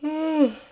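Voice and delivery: high contented loud sigh; loud happy sigh. Real, not acted. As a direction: sigh with joy.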